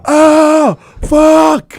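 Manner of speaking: high, loud, drawn-out cry; long startled yell of pain; reenacted shocked scream. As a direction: yell out as if just whacked awake. Reenacts waking after being hit.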